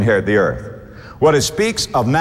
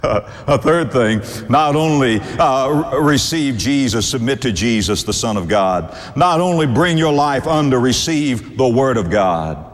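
ordinary talk

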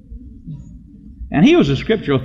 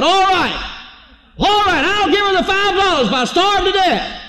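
high, strained outburst; pitched way up, giving in at last; exasperated giving in. Retold or imitated. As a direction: cry out in comic surrender.

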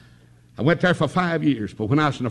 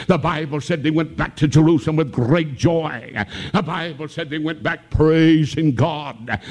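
ordinary delivery

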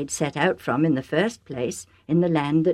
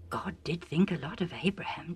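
whisper; 10 dB quieter than usual. Real, not acted. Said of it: "aside to listener".

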